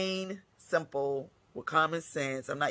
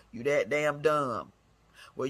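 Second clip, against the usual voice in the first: normal talking voice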